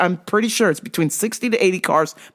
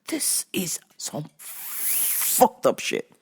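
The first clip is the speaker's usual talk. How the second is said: muttered disgust; 7 dB quieter, much slower than usual. Retold or imitated. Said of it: "I was like".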